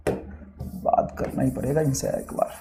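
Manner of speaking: under-the-breath whisper to himself; whispered aside. Real, not acted. Teacher to himself, annoyed at the question, whispered. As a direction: mutter it off to the side, barely voiced.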